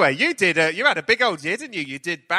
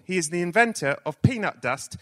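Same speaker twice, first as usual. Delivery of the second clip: ordinary delivery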